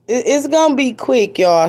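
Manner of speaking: ordinary delivery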